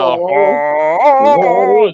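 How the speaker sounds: loud, high mock grunge wail; hammed-up singer parody, mouth full; potato-mouthed singer. Shown as a demonstration. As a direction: bellow it as if round a potato. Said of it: "you can sound like him".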